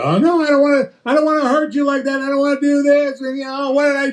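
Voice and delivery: high, fast, whiny backing-down voice; a man caving in to tears, in a high mock plea; mock pleading man. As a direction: squeak out a flustered apology. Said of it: "we go".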